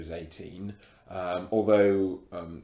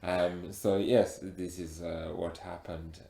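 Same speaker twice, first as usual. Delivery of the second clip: put-on heavy African accent; 6 semitones higher, 13 dB louder than usual. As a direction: speak with a thick African accent. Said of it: "you've got a very thick accent".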